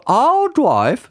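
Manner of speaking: the other bride wanting to drive; Reverend Judith. A narrator's reading. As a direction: insist over the auntie. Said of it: "said Reverend Judith".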